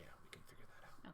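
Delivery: whispered aside. Real, not acted. Quick aside.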